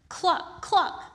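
high clucking; hen clucking. Acted. Hen noise.

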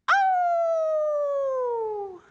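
long, high, loud wolf howl. Acted. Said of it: "made fun of her howl".

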